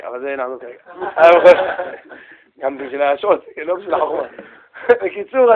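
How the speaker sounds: usual speaking voice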